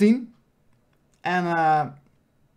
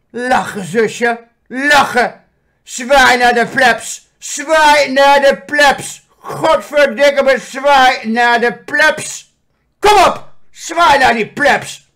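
high, loud slow commands; bossy sister shouting; 6 semitones higher, 11 dB louder, much slower than usual. Retold or imitated. Big sister forcing the younger to wave, loud and slow. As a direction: bark orders at little sister to wave. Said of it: "die grote zus. Dat is haar handler".

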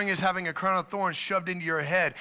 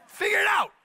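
a sudden, much higher shouted order; 13 semitones higher, 4 dB louder than usual. Real, not acted. Preacher scolding those who make bad decisions, shouting. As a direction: bark it at them to wake them up.

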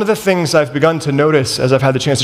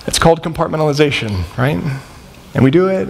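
normal talking voice

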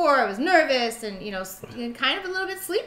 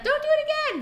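put-on very high, pleading child; whiny little boy. Boy pleading with the nurse, high and whiny. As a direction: whine like a kid in pain. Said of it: "kind of".

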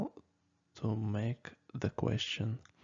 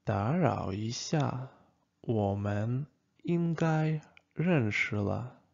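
我们应该认识了 said slowly and deliberately; slow it down for learners; careful, unhurried model sentence; slow repeat; slower than usual. Shown as a demonstration.

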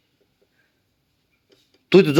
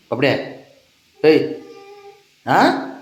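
normal talking voice

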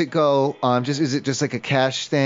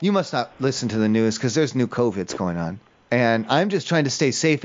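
ordinary delivery

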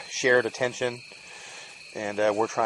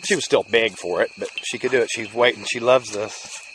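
usual speaking voice

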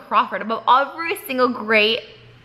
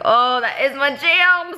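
high, drawn-out mock swoon; delighted quote; 6 semitones higher, 3 dB louder, slower than usual. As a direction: relive the thrill, slowly stretched. Acts out delight at song title.

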